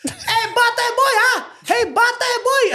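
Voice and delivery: put-on high, loud Filipino-accented holler; Tito Per's Filipino accent. Uncle yelling out the car window, Filipino accent. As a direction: yell it out in a comic Filipino accent. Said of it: "In his funny little Filipino accent".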